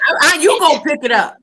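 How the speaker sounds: high, loud bossy command; bossy order. Retold or imitated. Friend mocking a rude demand, loud high bark. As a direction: throw it at them like an order. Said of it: "it was the way you said it".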